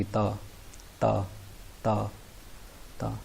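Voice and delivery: teacher repeating ता, each one spoken clearly; Chinese syllable da demo. Shown as a demonstration. Teacher drilling students on ता in a clear demo voice. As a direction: say ता slowly with the tongue touching the teeth. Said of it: "डी को पढ़ते हैं ता".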